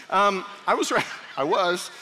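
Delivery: breaking into real laughter mid-sentence; can't get the words out for giggling; real laughter through a stalled sentence; laughing. Real, not acted. Cracks up mid-sermon.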